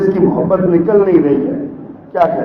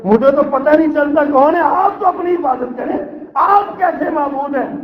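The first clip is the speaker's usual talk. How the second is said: higher, exasperated scolding that slows at the end; angry reproach; 7 semitones higher than usual. Retold or imitated. Old man rebukes his useless idol angrily. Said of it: "حضرت عمر غصہ آ گیا".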